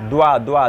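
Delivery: দ্বা with a clear w-glide; দ্বা, the দ্ব cluster stressed; careful pronunciation. Shown as a demonstration. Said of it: "দয় বয় আকার আছে না?". (দ্বা স্পষ্ট করে বলা)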